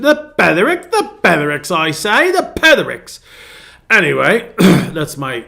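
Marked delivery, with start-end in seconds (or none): 0.0-1.7 high, hammed-up British accent; feeble British accent. Shown as a demonstration. Pastor playfully trying out a channel's name, feeble British accent. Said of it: "very feeble attempt at a British accent". 1.7-3.2 mock-British, pitched high and louder; a hammed-up attempt at British English; feeble British accent. Shown as a demonstration. Pastor showing off the channel's name, feeble British accent. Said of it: "very feeble attempt at a British accent".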